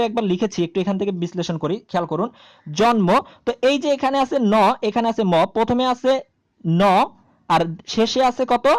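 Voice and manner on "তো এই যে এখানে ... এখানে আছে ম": usual speaking voice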